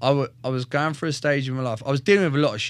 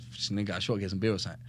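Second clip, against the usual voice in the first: hushed; 5 semitones lower, 8 dB quieter, faster than usual. Retold or imitated. Hiding the news from his missus.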